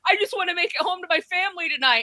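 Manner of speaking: high, pleading parody of a scared newsman; mock tearful correspondent. Host imitates a CNN correspondent almost in tears, high pleading parody. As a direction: whine it like a correspondent near tears. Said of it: "he's like".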